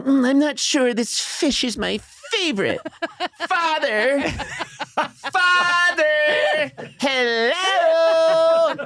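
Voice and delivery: put-on high, prim kid voice; prissy boy demanding his father's attention; prissy child calling father. Son calls to his father over dinner. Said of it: "here I am saying".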